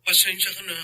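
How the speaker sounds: barely gets the word out; choked apology. Acted. Husband apologizes to his wife, choked with tears.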